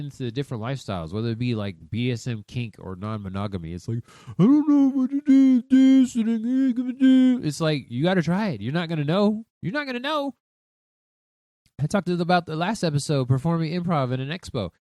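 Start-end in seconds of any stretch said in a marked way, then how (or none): 4.4-7.4 high, slow dithering mock voice; mock confused person. Mocking confused people. 9.6-10.3 squeaky mock echo. Shown as a demonstration. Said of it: "repeated line".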